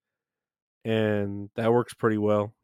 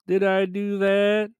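Urkel impression; 9 semitones higher, slower than usual. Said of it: "one of Urkel's, uh, quotes".

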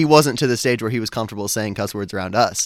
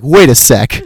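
louder, startled realisation; mock double take; 3 semitones higher, 10 dB louder, slower than usual. Older sibling realising the brother swore, startled. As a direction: catch what he just said. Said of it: "and then I was like".

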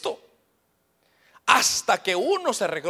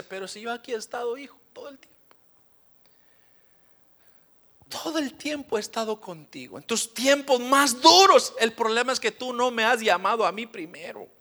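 gentle voice of the Lord; 2 semitones higher than usual. Acted. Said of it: "Y el Señor le dice".

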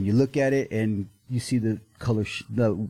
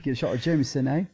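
put-on Canadian accent; 6 semitones higher, faster than usual. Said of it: "it's my Canadian accent".

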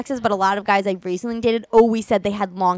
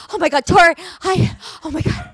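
high, gasping urgency; mock out of breath; 6 semitones higher than usual. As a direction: imitate mom panting between broken phrases. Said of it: "you were like, out of breath".

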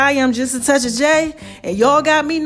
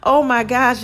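normal talking voice

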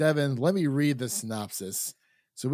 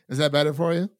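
ordinary talk

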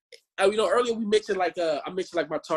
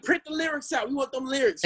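lightly voiced clamoring teens, pitched higher; a higher, mock-pleading voice; imitating eager fans; 7 semitones higher than usual. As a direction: demand it like keen young fans. Mimics girls demanding the lyrics.

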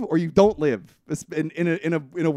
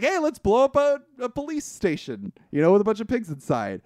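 eager informant quoted; 8 semitones higher, 4 dB louder than usual. Retold or imitated. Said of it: "And he's like".

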